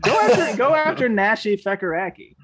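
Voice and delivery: high loud laughing plea; excited. Real, not acted. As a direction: shout it out amid laughter. Laughing protest.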